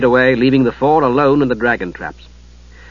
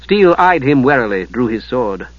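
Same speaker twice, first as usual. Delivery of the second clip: ordinary delivery, nothing marked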